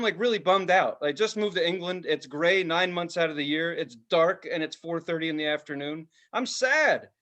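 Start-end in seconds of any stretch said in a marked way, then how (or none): none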